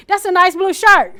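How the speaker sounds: loud, high repeat for the hard of hearing; loud repeat to the listener. Shown as a demonstration. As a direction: raise your voice at them. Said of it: "we're supposed to get louder".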